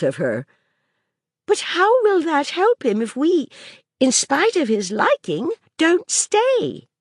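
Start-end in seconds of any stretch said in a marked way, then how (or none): 1.5-6.9 put-on higher, earnest little girl's voice; a young girl working things out aloud; child Maisie. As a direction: speak as the curious child arguing.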